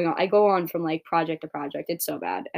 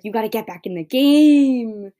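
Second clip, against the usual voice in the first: friend's loud push; 4 semitones higher, 9 dB louder than usual. Retold or imitated. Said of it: "he was like".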